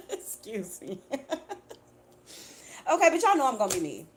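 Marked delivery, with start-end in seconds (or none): 0.0-1.2 giggling through an excuse me; embarrassed laugh after burping; real laughter, high then fading. Real, not acted.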